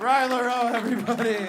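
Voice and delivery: rally applause for the departing comic; emcee shout-out. Real, not acted. Host calls applause for departing comic.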